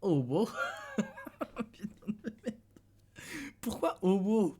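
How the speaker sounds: high, breathless giggles through the words; can't believe what the app just said; laughing in disbelief. Real, not acted. Reacting to the Duolingo app's odd word, laughing in disbelief.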